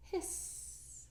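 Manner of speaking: plain reading voice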